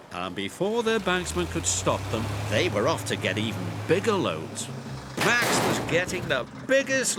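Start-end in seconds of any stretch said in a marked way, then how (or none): none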